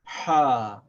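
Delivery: Ha constricted in the throat; throaty Ha. Shown as a demonstration. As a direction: squeeze Ha from the throat. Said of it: "And this is called".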